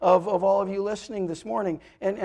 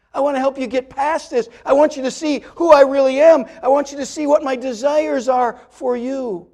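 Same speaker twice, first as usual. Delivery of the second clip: loud, higher preaching voice; preaching at full force; 6 semitones higher, 11 dB louder than usual. Acted. Pastor pleading with listeners, voicing God's offer of help, loud and high. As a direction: preach it out to the whole room, voicing God pleading.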